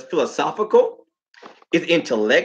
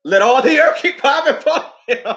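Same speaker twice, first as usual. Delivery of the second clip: put-on higher, louder old-lady preaching, breaking into a laugh; quoting grandma; 9 semitones higher, 3 dB louder than usual. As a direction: proclaim it like grandma did, laughing through the end. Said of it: "she would quote".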